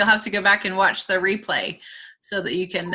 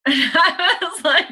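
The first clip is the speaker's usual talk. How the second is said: laughing; 9 semitones higher than usual. Real, not acted. Laughing at the analogy.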